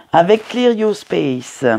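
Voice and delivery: "Clear Your Space" said slowly in a French-accented English; slipping into English. Real, not acted. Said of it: "Pourquoi j'ai dit ça en anglais".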